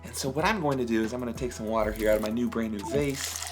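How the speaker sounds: ordinary talk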